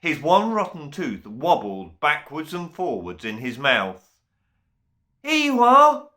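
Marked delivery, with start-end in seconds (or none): 5.2-6.1 high, loud little creature call. A narrator's reading. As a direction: shout up to the Toothbats. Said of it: "Rhys William called out".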